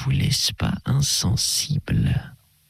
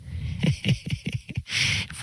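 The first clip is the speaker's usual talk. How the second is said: petit rire doux et soufflé de l'abbé; l'abbé rit doucement de la crainte de Durtal; 6 dB quieter than usual. A narrator's reading. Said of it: "l'abbé se mit à rire". (rire léger et bienveillant)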